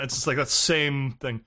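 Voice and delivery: ordinary talk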